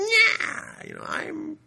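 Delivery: squeaky high, slow villain whine. Pretend. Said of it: "that other villain voice".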